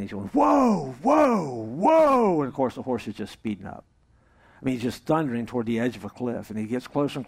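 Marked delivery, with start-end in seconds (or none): 0.3-2.4 high, loud, long falling whoas; panicked shout. Acted. Cowboy on a bolting horse, panicked shouting. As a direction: yell like a rider on a runaway horse. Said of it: "He's panicking".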